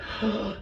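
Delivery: quick gasp sucking air in; sharp inhale. Shown as a demonstration. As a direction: suck the air in fast. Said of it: "I sucked in".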